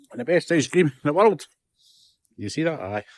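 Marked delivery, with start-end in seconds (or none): none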